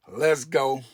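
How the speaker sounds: loud, hyped-up celebration shout; excited cheer. Real, not acted. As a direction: cheer it like a milestone win. Celebrating nine thousand subscribers.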